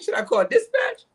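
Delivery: high-pitched, squealing alarm; mock panic. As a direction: play it panicked, voice way up. Acting out fear of approaching man.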